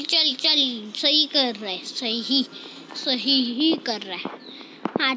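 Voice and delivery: normal talking voice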